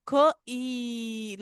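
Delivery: ख, then ई held long; long E, sounded out. Shown as a demonstration. Teacher breaking keep into sounds, long ई. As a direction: separate ख and stretch ई. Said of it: "long ई का sound आ रहा है".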